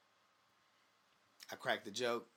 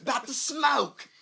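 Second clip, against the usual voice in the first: much higher and louder, drawn out slowly; mock pleading; 14 semitones higher, 13 dB louder, slower than usual. A J Styles begging a friend to back his joke, mock pleading. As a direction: plead it in a squeaky mock whine. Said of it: "you looking back at him like".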